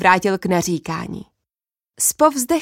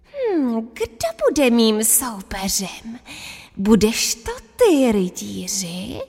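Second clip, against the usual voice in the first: croaking voice; 3 dB quieter, slower than usual. Acted. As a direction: menace them from the lair. Wizard asks for opponent.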